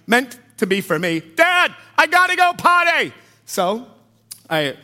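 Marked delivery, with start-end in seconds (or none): 1.2-3.1 put-on loud, high child's yell. Son yelling to dad on a packed plane. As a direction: shout it for the whole plane. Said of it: "my son yells to the whole plane".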